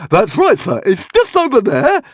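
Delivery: high, loud squeaky creature voice; Hogfly squeak. Acted. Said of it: "squeaked the Hogfly".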